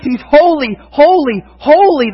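ringing threefold holy; triple holy proclaimed. Shown as a demonstration. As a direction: build the holys to a climax. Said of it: "They say it three times".

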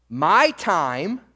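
usual speaking voice

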